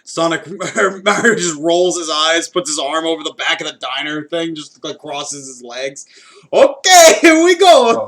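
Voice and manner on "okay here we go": loud, high, hammed-up exasperation; loudly put-upon; exasperated. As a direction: throw it out as a big here-we-go complaint. Mario rolls his eyes, exasperated.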